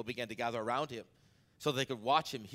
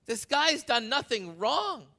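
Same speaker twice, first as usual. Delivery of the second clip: much higher, pleading Pilate voice; 12 semitones higher, slower than usual. Retold or imitated. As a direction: Pilate begging the crowd. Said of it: "Pilate's like".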